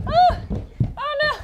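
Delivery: high little oh-no; playful alarm. Pretend. Teacher crawls as a tiny ladybug, playful alarm. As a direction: fuss like a struggling ladybug.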